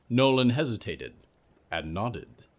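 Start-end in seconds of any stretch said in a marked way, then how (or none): none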